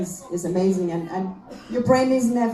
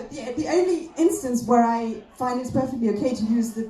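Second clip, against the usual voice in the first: ordinary talk, nothing marked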